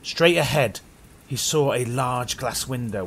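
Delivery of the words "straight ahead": usual speaking voice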